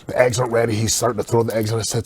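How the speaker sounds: ordinary talk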